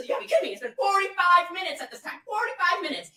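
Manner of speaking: high, loud exasperation; exasperated. Real, not acted. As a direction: vent it out. Exasperated retelling.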